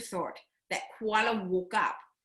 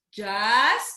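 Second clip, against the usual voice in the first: just stretched and louder, rising; drawn-out just; 6 dB louder than usual. Shown as a demonstration. Teller teasing kids, holding a long just. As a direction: hold just and lift it up.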